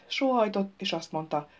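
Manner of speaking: ordinary delivery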